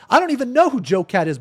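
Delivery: ordinary talk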